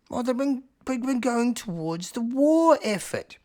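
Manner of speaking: high, slow elder voice; Bobby. Acted. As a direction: drag it out high, reluctantly explaining. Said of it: "Bobby says".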